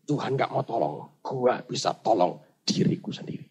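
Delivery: low, soft stubborn muttering; weakened stubbornness. Acted. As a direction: insist quietly with strength running out. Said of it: "makin lemah, dia bertahan".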